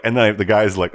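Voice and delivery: ordinary delivery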